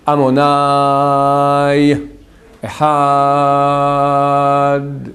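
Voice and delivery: very slow, drawn-out Shema; slow prayer recitation. Shown as a demonstration. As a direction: chant it slowly, stretching every word. Chants the Shema slowly. (récitation lente du Shema)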